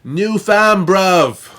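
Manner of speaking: loud, high, hammed-up British shout; playful fake British yell; put-on British slang accent. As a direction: shout it like a mock UK roadman. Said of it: "people trying to do the accent".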